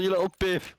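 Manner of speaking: ordinary delivery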